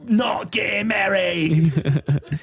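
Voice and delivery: high, slow, drawn-out Aussie ad parody; Australian commercial voiceover. Acted. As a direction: stretch each slogan in a hammed-up Australian accent. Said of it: "voiceover for an Outback Steakhouse commercial".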